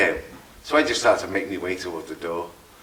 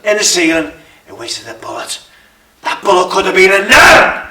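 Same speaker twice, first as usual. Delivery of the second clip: high, loud raging outburst; angry shout; 9 semitones higher, 14 dB louder than usual. Acted. Gunman cursing the wasted bullet, angry shout. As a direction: bellow it in frustration.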